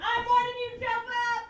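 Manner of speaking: loud, high, steady threat; shouted warning. Acted. Waitress warning man in kitchen scuffle, shouting. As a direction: yell it as a last warning.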